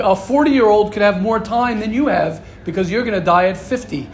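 ordinary talk, nothing marked

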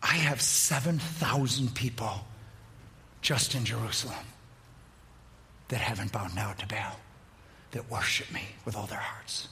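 low, soft, almost whispered; God whispering low and soft to Elijah; small voice of God. Acted. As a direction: hush it like a still small voice. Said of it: "God comes finally in a small voice, a whisper".

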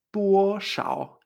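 DUOSHAO, DUO alto y plano, SHAO grave; sostén DUO arriba y baja SHAO al fondo; tono uno tres. Shown as a demonstration. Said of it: "El uno tres". («duoshao»: alto sostenido y luego bajo)

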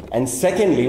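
normal talking voice

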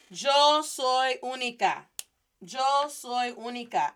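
slow, emphatic, each word separate; slow model sentence. Shown as a demonstration. Teacher modelling yo soy única for learners, slow and emphatic. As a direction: lay out each word clearly.

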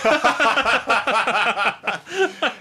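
loud burst of laughter; big laugh. Real, not acted. Laughing at bleach joke.

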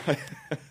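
a quick airy chuckle; amused little laugh; soft breathy laugh. Real, not acted. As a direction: laugh under your breath at the verse. Preacher laughs at verse.

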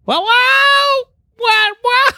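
very high, loud yowling wow; a cat squealing wow wow out loud. Retold or imitated. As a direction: yowl it like a talking cat. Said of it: "he just goes".

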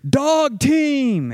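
sharp high shout of alarm. Retold or imitated. Phil warning the group of a dog team, shouting. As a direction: yell the warning over the wind. Sudden alarmed call in storm.

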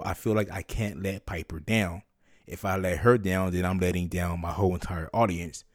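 normal talking voice

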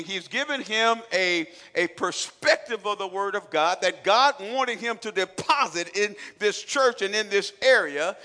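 loud, slow, booming sermon delivery; preaching at full force. Real, not acted. Pastor preaching hard to his church, loud and slow. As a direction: thunder it at the congregation.

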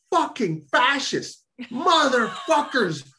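high-pitched, indignant tirade; outraged cussing. Retold or imitated. Imitating lawyers overheard on the other line, outraged cussing. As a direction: rant furiously, stretching out the curses. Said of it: "stream of cussing".

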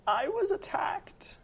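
put-on high, slow old woman; old lady voice. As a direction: drag it out as a frail granny. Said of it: "old lady voice".

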